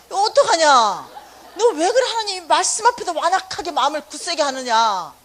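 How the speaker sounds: loud, high, laughing sermon rebuke; mock preacher scolding. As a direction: thunder at him like a revival preacher.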